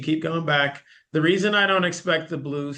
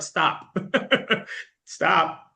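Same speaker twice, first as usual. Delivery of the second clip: laughing; 5 semitones higher than usual. Retold or imitated. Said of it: "he's like".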